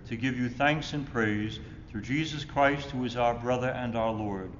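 ordinary talk, nothing marked

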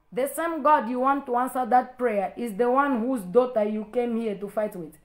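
usual speaking voice

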